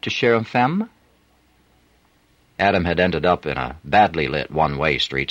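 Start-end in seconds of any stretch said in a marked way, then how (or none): 0.0-0.9 higher French woman's voice; French accent. A narrator's reading. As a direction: coax it with a French lilt. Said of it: "a woman's voice repeating".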